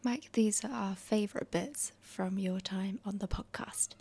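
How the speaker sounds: whispering. Real, not acted. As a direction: whisper it close to the mic. Reads farewell compilation intro.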